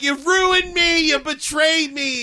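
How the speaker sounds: slow, high, loud melodramatic wail; mock a wronged character, dragging out each word; hammed-up accusation. Acted quote of betrayed film character.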